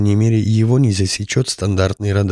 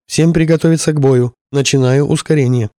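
louder, higher battle order; commanding shout; 5 semitones higher, 3 dB louder than usual. Acted. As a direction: bark the command to the crew. Pilot orders crew to battle.

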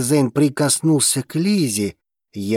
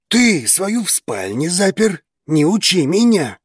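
angry snarl, higher; growling; 7 semitones higher, 3 dB louder than usual. A narrator's reading. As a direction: bite back at him. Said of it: "рыкнул Драгос".

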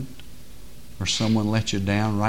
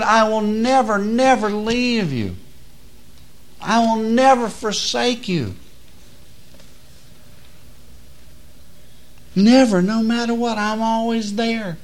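slow, high, stressed never; God's promise; 10 semitones higher, slower than usual. Acted. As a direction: let each never land slowly.

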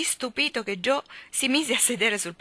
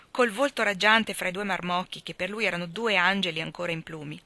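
ordinary delivery

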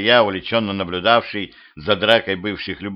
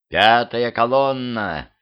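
slow, drawn-out mystery; mysterious; slower than usual. A narrator's reading. Cyborg hints at her secret plan, mysterious. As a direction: stretch it out enigmatically. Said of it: "загадочно произнесла Дуся".

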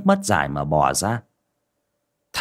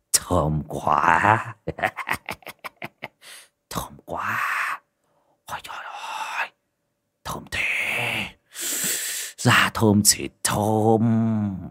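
put-on leering old man; 4 dB quieter, much slower than usual. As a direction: savour each word like a leering lecher. Lecherous man sniffing captive girl.

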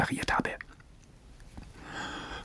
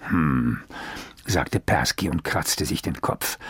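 usual speaking voice

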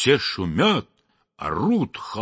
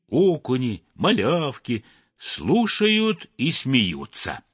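ordinary delivery, nothing marked

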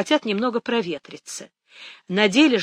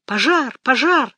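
raised, louder cry of fire; shouting alarm; 4 semitones higher, 5 dB louder than usual. A narrator's reading. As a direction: call the alarm into a telephone. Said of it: "закричал он в трубку".

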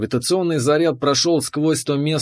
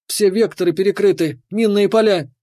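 high, urgent alarmed report; frantic; 6 semitones higher than usual. A narrator's reading. Kira warning the pilot of minefields, frantic and high.

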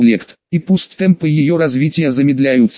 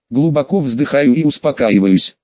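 ordinary delivery, nothing marked

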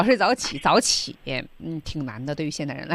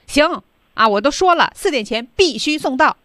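firm, louder and higher phone voice; brisk phone orders; 7 semitones higher, 7 dB louder than usual. Acted. Wedding organiser to a supplier on the phone, bossy and loud. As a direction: order the supplier about, loudly.

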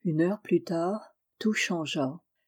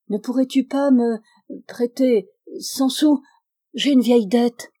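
halting, higher and louder plea; stammering; 4 semitones higher, 6 dB louder than usual. A narrator's reading. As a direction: break the request up with awkward pauses. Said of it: "Elle bégayait".

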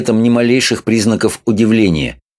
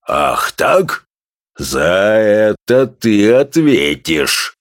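professor's voice; much slower than usual. A narrator's reading. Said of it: "промолвил он".

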